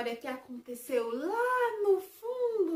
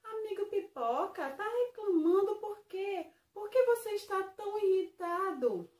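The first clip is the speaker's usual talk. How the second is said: high, bright little fish voice; a small fish asking, pitched up; Splash's voice; 7 semitones higher than usual. Acted. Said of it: "Splash perguntou". (voz aguda de peixinho)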